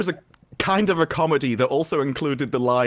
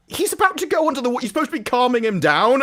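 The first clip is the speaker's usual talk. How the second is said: much higher, fast, exasperated objection; exasperated retort; 9 semitones higher, faster than usual. Real, not acted. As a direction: protest at the screen in disbelief.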